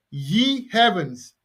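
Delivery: usual speaking voice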